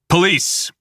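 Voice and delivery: a sharp high shout; shouting police. Acted. Officer calling out at the motel door, loud. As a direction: announce loudly at the door.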